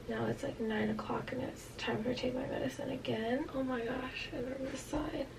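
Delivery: unvoiced hushed whisper. Real, not acted. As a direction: keep it to a breathy hush. Whispering while recovering.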